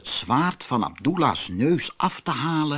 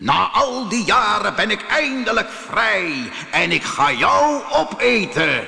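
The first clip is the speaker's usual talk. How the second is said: high-pitched, menacing genie roar; 7 semitones higher than usual. Acted. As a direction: bellow the threat as a giant spirit. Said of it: "brulde de geest". (brullende, dreigende geest)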